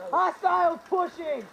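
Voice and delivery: loud, slow warning cry; alarmed shout. Real, not acted. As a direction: warn the team as they push. Warning of enemy push.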